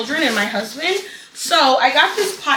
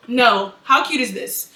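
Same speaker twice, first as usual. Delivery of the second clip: usual speaking voice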